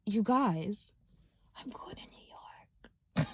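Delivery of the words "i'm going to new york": slow breathless whisper of disbelief; excited whisper. Real, not acted. Telling viewers she's moving to New York, whispered. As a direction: hush it like a secret too good to be true.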